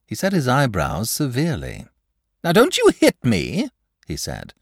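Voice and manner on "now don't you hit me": high, loud fussy dragon voice; a nervous, huffy dragon pleading. Acted.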